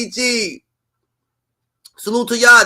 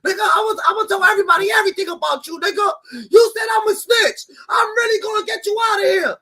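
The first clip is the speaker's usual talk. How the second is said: mock furious rant; 8 semitones higher than usual. Impersonating Wack threatening someone who called him snitch, shouting. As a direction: yell every line like an enraged gangster.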